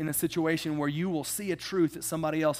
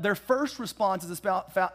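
ordinary talk, nothing marked

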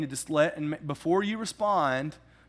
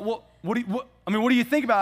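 higher, louder pushy question; pressing caller; 6 semitones higher, 5 dB louder, much faster than usual. Retold or imitated. Agent pressing on the phone, a higher insistent question. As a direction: push him for an answer. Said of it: "He's like".

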